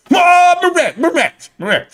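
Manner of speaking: high excited yelp, then dropping; mock a voice jumping up an octave with excitement; Data Zero. Streamer mimicking an excited YouTuber, high mock shout. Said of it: "Have you heard Data Zero?".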